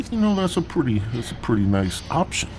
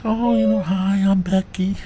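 Becky; 11 semitones higher than usual. Retold or imitated. Becky voiced in a stand-up story.

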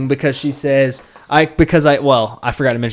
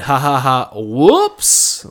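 awkward little laugh then whoops; fake sheepish laugh; 3 dB louder, much slower than usual. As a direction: laugh it off, embarrassed. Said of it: "like that".

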